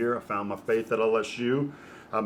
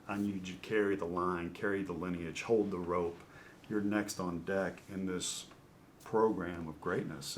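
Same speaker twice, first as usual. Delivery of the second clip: softer, intent recruiting plea; hushed coach pitch; 4 semitones lower, 10 dB quieter than usual. Retold or imitated. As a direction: lean in and sell it quietly. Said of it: "And he goes".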